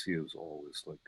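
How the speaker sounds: low, hushed, confidential undertone; like covertly selling reefer in a men's room; Glenn O'Brien impression. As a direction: imitate a man you can barely hear. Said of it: "he pitched his voice".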